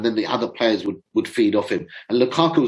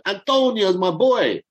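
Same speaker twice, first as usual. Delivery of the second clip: drawn-out, higher-pitched bad Italian accent; horrible mock Italian accent; 6 semitones higher, slower than usual. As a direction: put on a badly faked Italian accent, slowly. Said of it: "Sorry for that horrible accent there".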